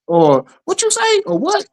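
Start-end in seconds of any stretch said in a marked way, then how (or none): 0.7-1.2 much higher, shocked "What you say?"; reacting to surprising news in high disbelief; surprised. Shown as a demonstration. 1.4-1.7 much higher, shocked "What you say?"; reacting to surprising news in high disbelief; surprised. Shown as a demonstration.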